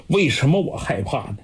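usual speaking voice

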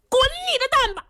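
high, furious bellow; angry shout. Acted. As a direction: roar it, raising the pitch. 程咬金 storms off. (愤怒地高声大吼)

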